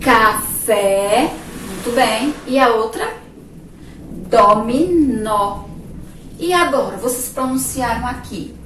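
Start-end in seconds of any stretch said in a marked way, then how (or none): 0.0-1.3 Café said louder, with a rising, strong open final É; Café ending in a clear open É; teacher's model. Shown as a demonstration. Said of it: "Pronunciem aí". 4.3-5.6 Dominó drawn out slowly and loud, stressing the final Ó; Dominó with a strong, long open final Ó; slow, loud model. Shown as a demonstration. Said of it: "Pronunciem aí".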